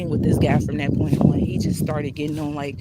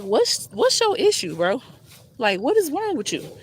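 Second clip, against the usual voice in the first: higher pitched, exasperated challenge; confronting annoyance; 6 semitones higher than usual. Retold or imitated. Confronting the staring maintenance guy, exasperated and high. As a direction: snap back at him, pitch way up.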